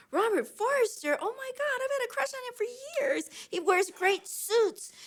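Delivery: high-pitched fangirl gushing over the actor; giddy excitement. Retold or imitated. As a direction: gush like a starstruck fan. Gushing over her crush.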